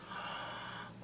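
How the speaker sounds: quick breathy gasp of shock; a soft, shocked intake of breath; soft unvoiced gasp. Real, not acted. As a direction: gasp at the reveal. Realising the son died.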